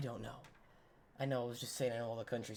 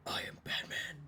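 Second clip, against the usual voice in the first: gravel-free whispered Batman line; breathy mock superhero whisper; playful Batman impression; 3 dB quieter, slower than usual. Shown as a demonstration. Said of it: "Sometimes it sounds a little cool, like".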